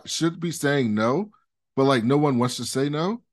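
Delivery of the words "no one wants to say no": usual speaking voice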